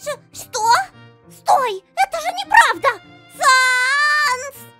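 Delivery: very high, distressed shouting; high wail building to a shout; panicked protest. Acted. Protests being handed over.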